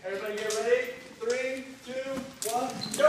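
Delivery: calling the countdown loudly. Real, not acted. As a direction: shout the start signal. Starting the game countdown.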